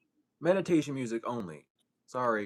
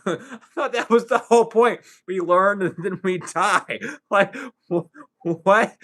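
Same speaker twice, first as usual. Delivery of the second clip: loud, higher-pitched, cracking up mid-sentence; real laughing; 4 semitones higher, 9 dB louder than usual. Real, not acted. Speaker cracking up over fearing death, laughing loudly. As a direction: laugh through the words, incredulous.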